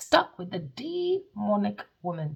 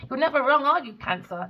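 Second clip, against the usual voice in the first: usual speaking voice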